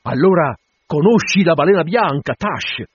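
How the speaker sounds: loud, high, eager question; excited captain pressing for an answer; urgent questioning. Acted.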